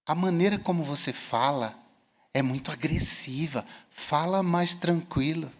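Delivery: low, soft, kindly admonition; humble, gentle correction. Retold or imitated. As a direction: pull someone aside and advise them calmly. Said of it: "com humildade, não é pra esculachar". (correção mansa e humilde, em voz baixa)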